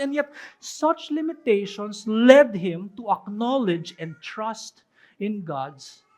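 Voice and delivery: ordinary talk, nothing marked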